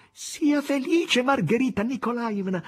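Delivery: high, slow, bright maid's cry of joy; joyful cry. A narrator's reading. Natasha bursting in, crying out to Margherita with joy. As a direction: call out to her in delight. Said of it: "gridò a Margherita".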